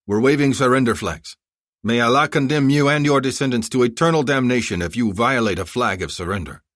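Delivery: stern warning. Acted. Enemy general warning by radio.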